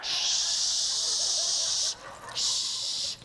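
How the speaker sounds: long, loud shh of rushing water; cartoon gushing spray. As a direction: imitate an endless cartoon toilet blast. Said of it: "It was like the cartoons".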